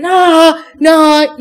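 loud high cries of no; loud, high protest from a possessed girl; pained cries. Retold or imitated. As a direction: cry out as if being clawed. Possessed girl writhing in pain.

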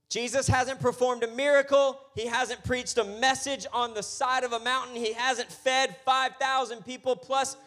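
high, driving sermon delivery; animated preaching. Real, not acted. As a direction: build the story with pulpit urgency. Preaches in a raised driving run.

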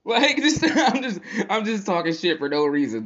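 laughing hard, voice jumping high and loud; cracking up at his own pettiness. Real, not acted. Vlogger to viewers, laughing loud and high.

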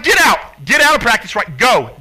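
loud angry command; furious order. Retold or imitated. Dad-coach kicking his son out of practice, furious. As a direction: throw him out in a rage. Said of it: "I lost it".

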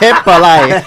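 laughing through the words. Real, not acted. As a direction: tease while laughing. Teasing laugh over a flop.

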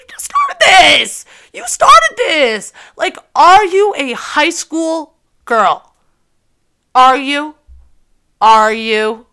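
high, slow, loud outraged accusation; indignant scolding. Retold or imitated. Scolding the thirty-year-old man, high and outraged. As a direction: drag out each word in disbelief.

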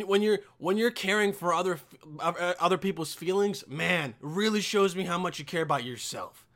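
normal talking voice

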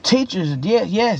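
ordinary talk, nothing marked

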